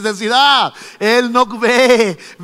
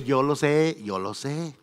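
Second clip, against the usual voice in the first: soft and low, the Lord calmly knowing; quiet knowing voice; 6 semitones lower, 8 dB quieter than usual. Acted. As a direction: hush it down, gently certain. Señor voiced calmly by preacher. (el Señor, en voz baja y serena)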